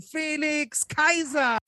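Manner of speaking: drawn-out high boxing ring shout; ring announcer. Acted. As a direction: bellow the name like a fight announcer. Introducing guest like ring announcer.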